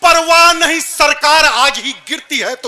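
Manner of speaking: loud, defiant declamation; forceful poetry recitation. Acted. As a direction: fire up the crowd for the refrain. Recites verse for audience response. (ललकार भरा काव्य पाठ)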